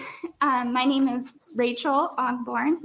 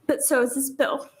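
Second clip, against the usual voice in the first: ordinary talk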